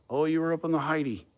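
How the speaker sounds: put-on playful Australian accent; imitated Australian accent. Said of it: "so his accent was like".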